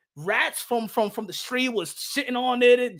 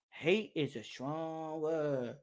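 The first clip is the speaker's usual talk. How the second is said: put-on softer, slower child voice; 7 semitones lower, 14 dB quieter, much slower than usual. Kid voiced again, softer.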